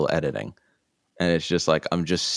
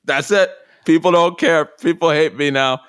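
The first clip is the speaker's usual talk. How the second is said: high, louder catastrophizing; mock panic; 10 semitones higher, 6 dB louder than usual. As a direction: act out a hammed-up spiral of panic. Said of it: "our sense of panic".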